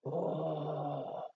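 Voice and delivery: usual speaking voice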